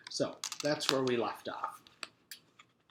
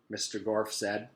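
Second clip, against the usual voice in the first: usual speaking voice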